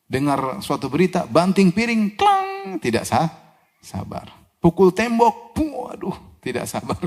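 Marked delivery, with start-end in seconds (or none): 1.3-2.8 mock plate crash. Imitating a plate smashed in anger. 4.6-6.2 mock pained outburst. Acting out a wall punch in anger.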